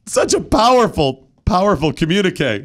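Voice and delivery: hammed-up tearful awe; mock choked up. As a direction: gush as if moved to tears. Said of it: "choked up here".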